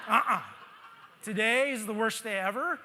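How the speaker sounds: mock grumpy lines laughed out loud; laughing. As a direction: laugh through a hammed-up complaint. Laughs while voicing his grumpy thoughts.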